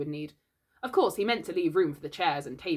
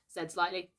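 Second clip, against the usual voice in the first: plain reading voice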